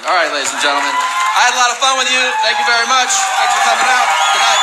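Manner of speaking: calling out to the crowd. Real, not acted. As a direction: send the crowd off with a big loud goodbye. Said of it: "sign-off to the crowd".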